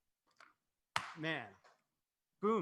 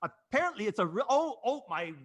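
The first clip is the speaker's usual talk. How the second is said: higher, faltering mock British accent; attempted British accent; 5 semitones higher than usual. Shown as a demonstration. Host struggling with the British accent they were asked to do, faked British accent. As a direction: stumble through a bad British accent. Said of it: "this is my British accent".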